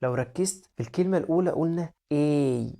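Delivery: ordinary delivery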